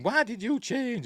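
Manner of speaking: high, loud mock outburst; acted shouting. Pearl confronting the projectionist as he cools, acted shouting. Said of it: "she starts shouting at him".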